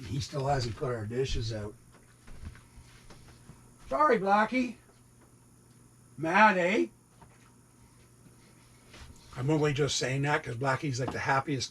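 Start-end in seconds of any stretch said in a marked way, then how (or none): 3.9-6.9 high gentle pet voice; pet talk. Real, not acted. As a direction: coo sorry to the cat. Apologising to his cat.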